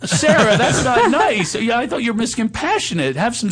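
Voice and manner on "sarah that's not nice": high, loud mock indignation; hammed-up telling-off; mock scolding. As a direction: scold playfully in a raised squeal.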